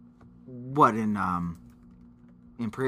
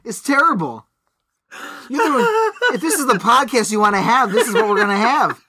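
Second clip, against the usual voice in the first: laughing; 7 semitones higher, 4 dB louder than usual. Real, not acted. Laughing at his own joke.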